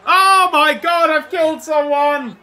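high, loud, slow shocked cry. Real, not acted. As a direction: gasp it out in horror. Puck hits spectator, shocked.

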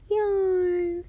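yawn. Acted. Character yawns before nap.

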